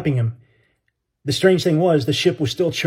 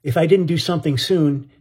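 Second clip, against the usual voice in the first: plain reading voice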